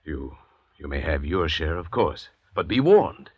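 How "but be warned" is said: stern warning. Acted. Doctor warns convict.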